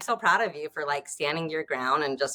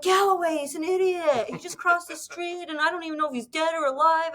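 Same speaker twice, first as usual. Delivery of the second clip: much higher, mocking gossipy guy voice; mocking golf buddies; 11 semitones higher than usual. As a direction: ham up the laughing golfers. Golfers gossiping about Galloway.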